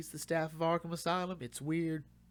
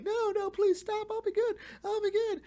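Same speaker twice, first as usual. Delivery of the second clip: much higher and louder, squeaky pleading; begging, whimpering Bane; 7 dB louder than usual. Retold or imitated. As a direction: cower and beg him to stop. Bane not fighting back, begging.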